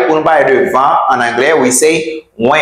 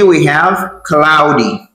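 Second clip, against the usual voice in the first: ordinary talk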